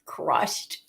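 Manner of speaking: whispered under the breath. Real, not acted. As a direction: breathe the word out with no voice. Reacting to grandma's insult.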